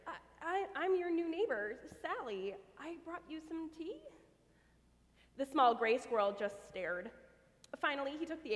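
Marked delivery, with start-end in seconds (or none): 0.1-4.0 stammering. Acted. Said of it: "Sally stammered".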